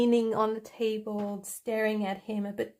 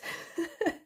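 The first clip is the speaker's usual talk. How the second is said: whispered giggle; 6 semitones higher than usual. Real, not acted. Amused at her own romance story, whispered giggle. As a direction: giggle under your breath.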